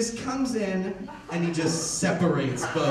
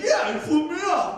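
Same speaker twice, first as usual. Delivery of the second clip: much higher, loud, aggrieved; indignant shout; 12 semitones higher, 6 dB louder than usual. Retold or imitated. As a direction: whine it out loud like a wronged drunk. Drunk guy confirms angrily.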